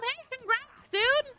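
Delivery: high-pitched, delighted cheer; excited congratulations. Real, not acted. Streamer congratulating viewer on a new job, excited. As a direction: gush with congratulations, voice way up.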